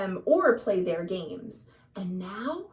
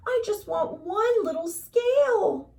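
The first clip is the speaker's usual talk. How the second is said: pleading little fish; 8 semitones higher than usual. A narrator's reading. Little blue fish pleading with the rainbow fish, high and timid. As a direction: coax him gently, small and hopeful.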